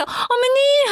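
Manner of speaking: very high, stretched-out syrupy call; exaggerated wheedling squeal; mock fawning. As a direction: mimic a flattering daughter-in-law mockingly. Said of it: "그렇게 알랑방구 끼면서".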